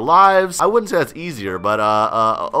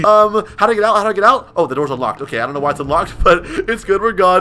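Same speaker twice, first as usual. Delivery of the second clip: usual speaking voice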